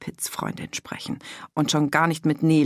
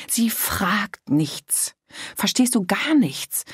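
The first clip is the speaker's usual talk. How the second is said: high, agitated outpouring; bursting out; 5 semitones higher, slower than usual. A narrator's reading. Mother venting to her daughter about the girlfriend, agitated and high. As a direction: let the frustration spill out, voice up high. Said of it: "Dann brach alles aus ihr heraus".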